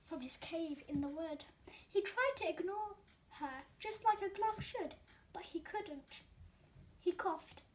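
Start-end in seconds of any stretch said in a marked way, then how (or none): 5.3-6.2 breathy hushed whisper on the last words. A narrator's reading. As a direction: let it drop to a bare whisper. Narrator whispers the glump's hesitation.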